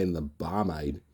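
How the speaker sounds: ordinary delivery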